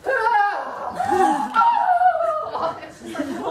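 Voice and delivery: loud, high hammed-up cries; mock overexcited vinyasa moans; mock yoga exclamations. Teacher mocking a noisy flow yoga class. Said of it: "everyone's just going".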